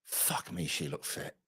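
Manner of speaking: hushed, breathy aside; whispered admiring aside. Real, not acted. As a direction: drop to a whisper as if not to be overheard. Aside about a beautiful woman.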